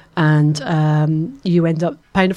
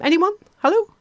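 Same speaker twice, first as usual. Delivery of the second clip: mock hopeful calling out; 14 semitones higher than usual. Real, not acted. No takers for the pasta.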